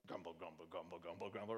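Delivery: low, soft grumbling mutter; grumbling sound. Shown as a demonstration. Preacher sounding out the word grumble, low mutter. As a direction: mutter it low like a discontented crowd. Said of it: "onomatopoeias that sounds like what it sounds like".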